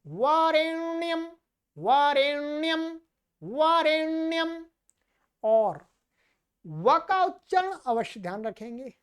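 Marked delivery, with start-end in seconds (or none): 0.0-4.7 वरेण्यम repeated with a clear nasal ण; careful pronunciation. Shown as a demonstration. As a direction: drill वरेण्यम slowly for the students. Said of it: "ण, ण।".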